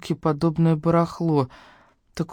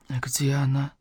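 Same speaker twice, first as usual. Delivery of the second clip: hushed question with hardly any voice; weak whisper; 8 dB quieter than usual. A narrator's reading. Injured brother asking after his daughter, weak whisper. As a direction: ask it softly on breath alone.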